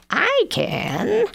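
high proud witch. Acted. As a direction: squeal it like a witch. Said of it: "said the witch".

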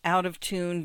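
plain reading voice

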